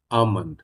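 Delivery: "almond" said as ah-mund with a silent L; "almond" modelled softly for repeating, no L; model pronunciation. Shown as a demonstration. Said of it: "উচ্চারণ করুন".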